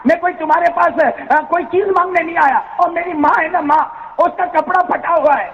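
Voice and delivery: put-on high, impassioned child begging; pleading orphan boy. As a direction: plead with everything, pitched high. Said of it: "بہت پیار سے کہا".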